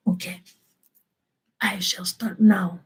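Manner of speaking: soft, near-whispered announcement; hushed lead-in. Acted. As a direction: drop to a hush before the scene starts. Setting up acted scene, hushed.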